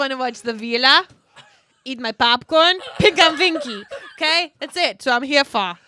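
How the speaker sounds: mock foreign accent, heavy on V sounds; made-up accent. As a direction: ham up an invented accent, loud. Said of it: "I don't even know what accent I just made up".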